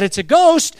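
hint of panic on the word ghost; a touch of fear. A narrator's reading. Disciples seeing a figure on the waves, mild scared cry. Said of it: "They cried out in fear".

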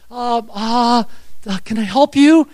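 tentative acted question; hesitant, flustered reply. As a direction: stall nervously, then offer help. Acting out his flustered reply.

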